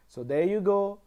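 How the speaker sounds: normal talking voice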